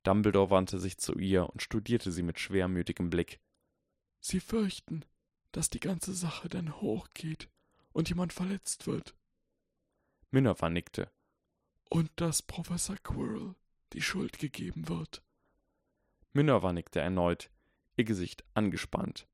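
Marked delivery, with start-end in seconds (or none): 4.2-9.2 put-on softly reciting old wizard. A narrator's reading. Dumbledore voiced in story. 11.9-15.2 put-on softly reciting old wizard. A narrator's reading. Dumbledore voiced in story.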